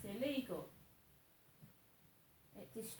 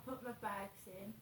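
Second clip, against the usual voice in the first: ordinary talk, nothing marked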